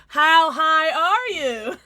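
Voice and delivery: slow, loud, mock-incredulous; drawn-out loud teasing question; teasing disbelief. Real, not acted. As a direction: stretch it out to mock the chatter. Teasing chat about a comment.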